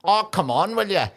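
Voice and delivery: high-pitched, lilting fake Irish; bad film Irish. Showing that's the accent, bad high film Irish. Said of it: "and that's the accent".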